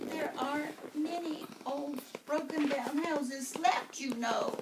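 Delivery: high witch voice; grumbling witch. Acted. Witch grumbling to the mother, high-pitched. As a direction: complain like an old witch.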